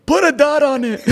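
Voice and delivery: lightly put-on Russian accent, high and laughing; Russian-accented cartoon villain, hammed up; Gru voice. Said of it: "pseudo Russian voice that sounds like Gru".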